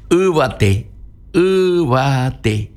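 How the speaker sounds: Ubate said slowly and high, ending in a fall; slow Guaraní word. Shown as a demonstration. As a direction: spell out Ubate with a clear U. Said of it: "teaching Guaraní word".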